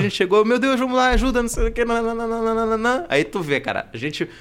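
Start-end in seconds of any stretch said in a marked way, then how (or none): 0.4-3.0 high flustered babble, trailing into nonsense; re-enacted panic. Driver arriving to help the cyclist, re-enacted panic. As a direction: hurry to help in mock alarm.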